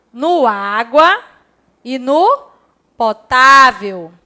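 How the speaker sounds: água and potável drawn out slowly, stressing á and tá; água and potável said slowly, stressed syllables marked; slow stressed words. Shown as a demonstration. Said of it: "onde teria o acento?".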